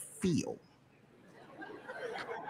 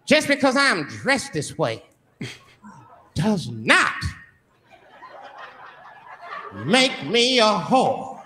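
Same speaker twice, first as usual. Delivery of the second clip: indignant woman; 3 semitones higher, slower than usual. Acted. Voicing offended girl.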